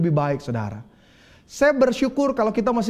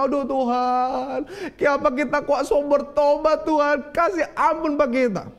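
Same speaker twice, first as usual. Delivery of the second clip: a touch of a weeping plea, drawn-out Aduh; lightly acted tearful prayer; mild mock crying prayer; 5 semitones higher than usual. Young man weeping to God at night, a hint of sobbing. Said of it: "satu malam dia menangis".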